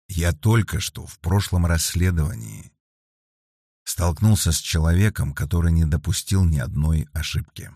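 ordinary delivery, nothing marked

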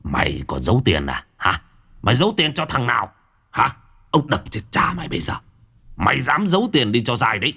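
mock drunken threats to his wife; menacing drunk husband. A narrator's reading. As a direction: shove the words at her face. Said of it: "lão sấn sổ dí vào mặt bà Xuân".

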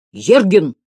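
sharp, forceful summons on ЕРГИН. A narrator's reading. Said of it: "тоном выше повторил Слушкин".

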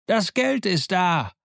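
high, drawn-out call from a window; slow, high-pitched shout. A narrator's reading. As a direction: holler the answer to someone below. Man calls answer down from window.

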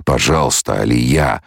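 ordinary delivery

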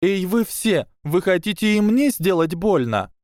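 put-on high, frightened girl calling out; thin trembling shout. A narrator's reading. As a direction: challenge them in a thin, shaky voice. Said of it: "крикнула тонким дрожащим голосом". (тонкий дрожащий выкрик)

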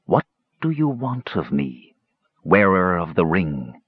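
slow, portentous otherworldly demand; eerie drawn-out voice, sinking at the end; disembodied voice. A narrator's reading. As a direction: intone it like a spirit being summoned. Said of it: "the voice thundered".

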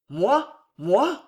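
incredulous, rising questions; a touch of stunned protest; shocked cry. A narrator's reading. Son cannot believe he is chosen, crying out in disbelief. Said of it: "s'écria-t-il".

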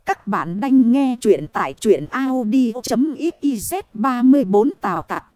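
plain reading voice

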